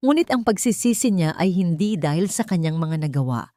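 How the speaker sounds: ordinary delivery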